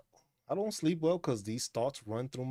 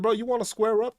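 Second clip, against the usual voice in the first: higher, louder challenge to the cat; mock challenge; 9 semitones higher, 8 dB louder than usual. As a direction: call the cat out, loud and high. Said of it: "I was like".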